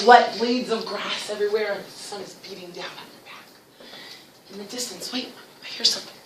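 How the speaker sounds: storytelling fading to very soft; hushed suspense. Pretend. Teacher leads class through an imagined jungle, hushed suspense. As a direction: draw them into the jungle quietly.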